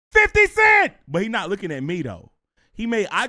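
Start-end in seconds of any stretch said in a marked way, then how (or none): 0.1-0.9 high, full-throated shouted name; kid yelling. Retold or imitated. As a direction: yell it across the arena like an excited kid. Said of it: "He keeps yelling [name]".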